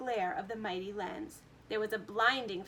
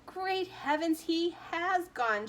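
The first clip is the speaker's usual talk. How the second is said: higher, slow dismayed shout; 7 semitones higher, 4 dB louder, slower than usual. A narrator's reading. Father shocked at his vanished son, slow shout. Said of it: "shouted Mister T V".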